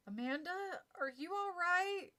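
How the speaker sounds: a touch of a scared little brother, drawn out slowly; lightly voiced frightened boy calling out; boy calling to his sister from the hallway, mildly whimpering. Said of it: "Josh whimpered from the hallway".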